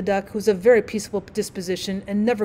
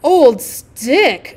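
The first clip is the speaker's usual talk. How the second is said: loud, emphatic affronted repeat; indignant echo; 4 semitones higher, 9 dB louder than usual. A narrator's reading. Rocket hearing the boy's name for him, loud indignation. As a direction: echo the insult in offended dignity. Rocket offended at being called stick.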